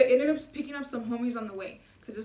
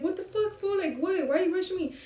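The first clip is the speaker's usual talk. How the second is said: higher-pitched mock indignant brother; 6 semitones higher than usual. Brother objecting to being rushed, retold as indignant. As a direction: imitate an annoyed brother protesting.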